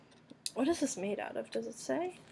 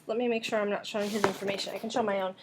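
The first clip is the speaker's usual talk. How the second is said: normal talking voice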